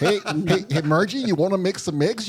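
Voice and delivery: put-on Minnesota accent. Calling to Margie about making eggs, hammed-up Minnesota accent. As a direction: sing it out in a mock Minnesota accent. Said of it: "You didn't come back with the accent".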